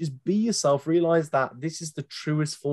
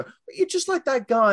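a high, loud mock gasp of recognition; lightly voiced fan, much higher; mock excited fan; 11 semitones higher, 3 dB louder than usual. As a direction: play an excited fan spotting a lookalike. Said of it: "I've had people go".

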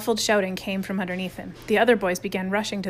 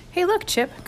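a bright high call down the table; 11 semitones higher, slower than usual. A narrator's reading. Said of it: "called Adam".

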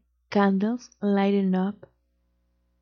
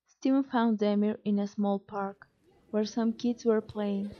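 ordinary delivery, nothing marked

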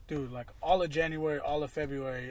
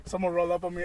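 normal talking voice

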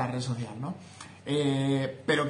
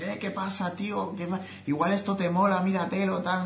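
higher-pitched, lightly imitated youth slang; casual young pitch; 6 semitones higher than usual. Someone young recommending content on TikTok, casual and dynamic. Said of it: "una jerga más diaria, más dinámica, más de gente joven".

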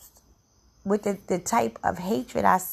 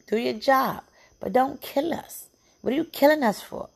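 real anger, voice raised high; anguished outrage; 6 semitones higher than usual. Real, not acted. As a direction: cry out against the killing. Pleading anger at police killings.

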